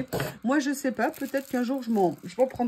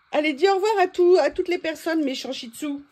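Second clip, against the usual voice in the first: higher, louder, fast cooing; 5 semitones higher, 5 dB louder than usual. Real, not acted. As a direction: coo at the pet to wave goodbye. Making pet say goodbye.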